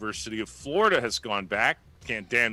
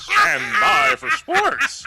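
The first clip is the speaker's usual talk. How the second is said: very high, loud, slow announcer drawl; Paul Harvey voice; 11 dB louder, much slower than usual. Retold or imitated. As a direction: stretch it out, big radio showman. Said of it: "I can do that on my own".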